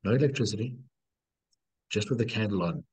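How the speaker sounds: ordinary delivery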